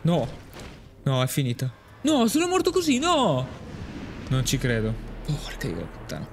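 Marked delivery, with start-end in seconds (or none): 2.0-3.5 real high-pitched despair; anguished cry. Real, not acted. Character dies in game. 5.2-6.3 low, soft curse under the breath; real defeated mutter, much lower and softer. Real, not acted. Frustrated after dying.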